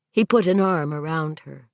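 ordinary delivery, nothing marked

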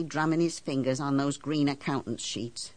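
usual speaking voice

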